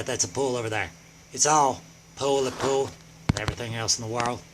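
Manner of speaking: low, soft, hurried patter; hushed, absent-minded aside to yourself; muttered rambling. Acted. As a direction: drop low and mumble along. Rambling on about the pole.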